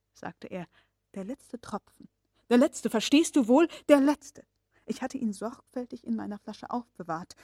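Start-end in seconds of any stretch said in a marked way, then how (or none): 1.1-4.5 insisting, building. Acted. Uncle stresses the last drop.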